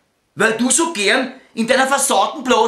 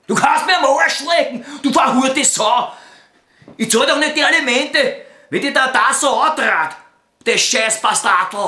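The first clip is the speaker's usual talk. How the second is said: wütendes Anschreien; 5 semitones higher than usual. Acted. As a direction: blow up at her, full rage. Jealous outburst at Beate on phone. (lauter, hoher Wutausbruch ins Telefon)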